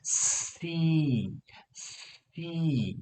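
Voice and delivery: સી with a long hiss flowing into a high, sustained ee; air into ee. Shown as a demonstration. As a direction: stretch both sounds of સી, s then ee. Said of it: "હવા કાઢો ને ઈનો અવાજ આવશે".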